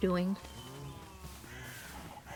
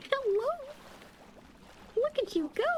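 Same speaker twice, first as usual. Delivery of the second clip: high cooing voice. Real, not acted. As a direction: coo at a tiny pet. Cooing at a pet axolotl.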